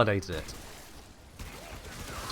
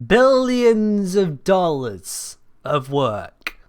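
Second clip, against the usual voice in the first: slow, loud, higher, dripping sarcasm; sarcastic disbelief; 6 semitones higher, 6 dB louder, much slower than usual. Real, not acted. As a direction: drag it out in exasperated mockery. Mocking the AI's silly answer.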